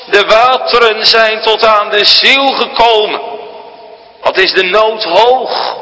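preacher crying Jonah's prayer to the congregation; impassioned preaching. Acted. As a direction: plead to God from the depths.

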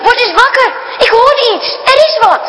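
put-on boy hearing something in the night, alarmed; frightened urgent boy. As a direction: wake her up, scared of the noise. Frightened boy wakes sister.

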